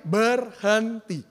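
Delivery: Berhenti stretched very slowly, syllable by syllable; emphatic BERHENTI. Shown as a demonstration. Pastor answering what God did, Berhenti slowly spelt out for emphasis. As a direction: draw out each syllable of Berhenti. Drives home key word from verse.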